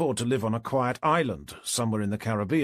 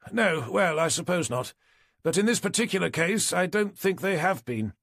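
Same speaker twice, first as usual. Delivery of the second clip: higher-pitched doctor voice; doctor conceding a point; 7 semitones higher than usual. A narrator's reading. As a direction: pitch the doctor up, slowing at the end.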